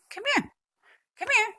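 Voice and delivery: very high, loud pet call; calling a puppy. Real, not acted.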